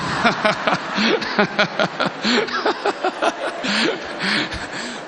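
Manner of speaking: long, rolling laughter; real hearty laugh. Real, not acted. Preacher amused by the rumour he just retold, laughing heartily. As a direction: burst out laughing at your own tale.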